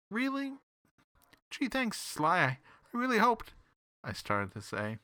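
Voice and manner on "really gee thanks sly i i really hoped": high, eager gushing; thrilled he might get a case. A narrator's reading. Detective gushes to Sly, high and eager.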